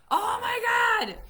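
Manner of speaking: high, loud, slow shout; exasperated shout. Retold or imitated. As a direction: cry it out in disbelief.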